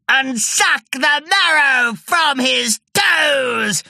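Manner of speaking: high, slow roaring shout; monster shout. A narrator's reading. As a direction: howl it gleefully.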